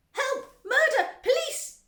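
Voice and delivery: high, loud hysterical cries; shouting. A narrator's reading. Mother watching son sucked up pipe, shouting. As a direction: shriek for help in short bursts. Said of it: "shouted Missus Gloop".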